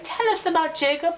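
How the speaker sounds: high-pitched little-fan pleading; eager mock fangirls. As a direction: beg like adoring young fans. Acting eager young Twihards.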